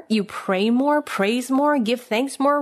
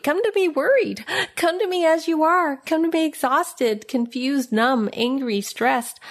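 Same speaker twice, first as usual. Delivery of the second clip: higher, earnest voice of Jesus calling; pleading invitation; 7 semitones higher than usual. Acted. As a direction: lift the voice as Jesus, gently urging. Voicing Jesus' gentle invitation.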